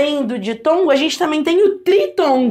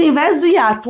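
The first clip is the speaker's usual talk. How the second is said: usual speaking voice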